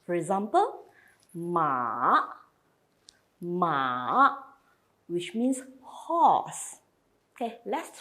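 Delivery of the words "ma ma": ma dipping down then rising; third tone. Shown as a demonstration. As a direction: dip ma low and bring it up. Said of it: "going down and going up".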